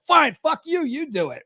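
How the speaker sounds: high-pitched angry reenactment; pissed-off outburst. Retold or imitated. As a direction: act out snapping back, fed up. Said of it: "getting all pissed off and go".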